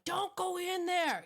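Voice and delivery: high-pitched yell at the screen; mock yell. Horror film viewer warning the blonde, mock yell. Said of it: "you're yelling at the T V screen going".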